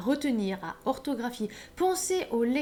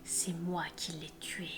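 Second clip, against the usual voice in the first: whisper; 6 semitones lower, 10 dB quieter than usual. Pretend. Killer's reveal quoted in whisper.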